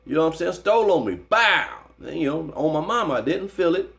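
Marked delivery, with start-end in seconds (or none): 1.1-1.7 punch sound effect. Retold or imitated. Imitating the punch landing.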